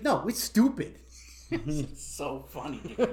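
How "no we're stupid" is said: mock Vietnamese accent. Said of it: "faking the Vietnamese accent".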